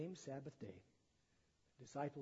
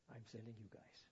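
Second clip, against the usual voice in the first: hushed whisper, much softer; 5 semitones lower, 13 dB quieter than usual. Acted. Jesus sending out his disciples, whispered. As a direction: breathe it out like a secret charge.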